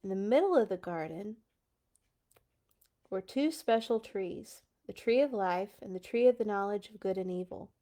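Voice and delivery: ordinary delivery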